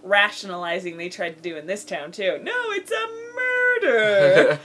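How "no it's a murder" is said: high, slow, hammed-up indignant shout; mock outraged townsperson. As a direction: play the deluded town denying it loudly. Mocking the rationalizing townsfolk.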